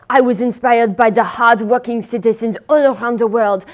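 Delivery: ordinary delivery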